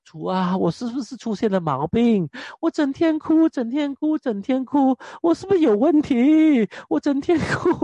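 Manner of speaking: 音调拔高的夸张哭诉; 半开玩笑地模仿自己向主哭诉; 向主诉苦. Retold or imitated. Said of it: "我说：". (牧师模仿当年的自己向主哭诉，语调高而夸张)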